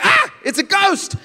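high, drawn-out scared shout; hammed-up terrified cry; acted fright. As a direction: shriek it like spooked disciples. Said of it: "they're afraid 'cause they're".

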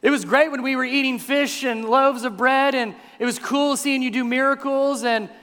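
high, loud mock complaint to Jesus; protesting follower. Voicing baffled followers complaining to Jesus.

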